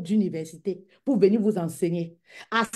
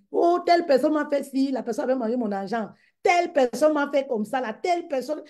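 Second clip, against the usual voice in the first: mock whining complainer; 6 semitones higher than usual. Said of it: "Tu vas être que".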